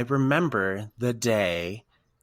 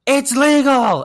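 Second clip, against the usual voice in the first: excited high shout; imitated yell, very loud and high; Casey; 12 dB louder, slower than usual. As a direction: holler the big news across the room. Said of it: "he yells at me again".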